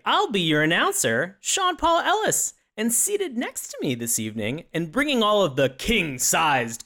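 booming ringside announcer voice; wrestling announcer. Acted. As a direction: hype it up like a fight night.

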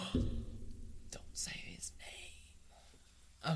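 hammed-up dread of a forbidden name; mock fearful whisper. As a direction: gasp then a slow terrified whisper. Gasps, mock fear of Voldemort.

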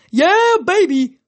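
high, loud, drawn-out party cheer; whooping, slow and high-pitched; wailing whoop. A narrator's reading. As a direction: wail it out like a rowdy reveler. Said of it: "a voice wailed".

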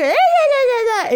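put-on baby babbling. Baby answering parent's vocal play. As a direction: imitate a baby babbling back. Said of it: "you hear Lil Sugar".

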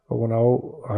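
ordinary talk

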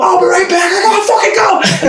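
high shouted reply, in a rush; answering roommate from the hallway, high frantic shout; frantic shout back. Retold or imitated. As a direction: shout it back while running out. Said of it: "I'm like".

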